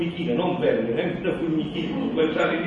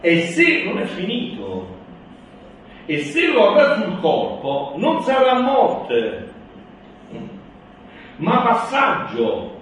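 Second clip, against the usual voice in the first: raised, louder solemn proclamation; preaching at full force; 6 semitones higher, 7 dB louder, slower than usual. Acted. Solemn reading.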